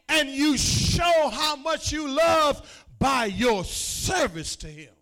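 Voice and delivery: loud, high preacher's cry; preaching at full force. Acted. Pastor calling out his flock, loud fervent preaching. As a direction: rebuke the church-skippers from the pulpit.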